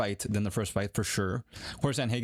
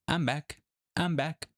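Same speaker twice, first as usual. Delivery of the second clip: Anderson Silva impression; 5 semitones higher, slower than usual. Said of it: "Just like Anderson Silva said".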